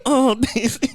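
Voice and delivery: very high gushing squeal, breaking into laughter; dog-talk voice. Shown as a demonstration. Owner greeting the dog Daisy, high dog-talk voice. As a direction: gush way up high over the dog. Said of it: "voice you use to talk to your dog".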